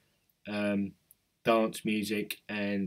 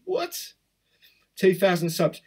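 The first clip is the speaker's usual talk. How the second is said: real surprise; 8 semitones higher, 5 dB louder, slower than usual. Real, not acted. Reacting to a sudden subscriber jump.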